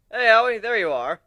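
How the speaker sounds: loud high hail across the deck; bright shouted greeting; calling out. Acted. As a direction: call out to him from afar. Hailing the wolf across deck.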